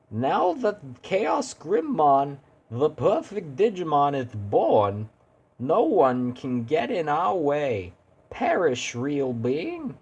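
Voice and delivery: high, slow gloating villain voice. Acted. Villain gloats that Chaos Grimmon is born, high and slow. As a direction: savour each word as the new god.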